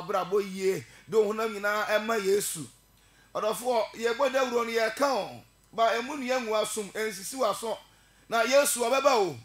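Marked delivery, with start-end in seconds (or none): 3.3-9.4 loud, higher fiery preaching; preaching at full force. Real, not acted. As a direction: call the congregation to repent, full voice. Preacher rises to full altar call.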